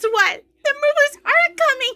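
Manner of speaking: high, giddy announcement breaking into laughter; laughing. Real, not acted. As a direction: share the news through real giggles. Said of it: "I don't mean to be laughing".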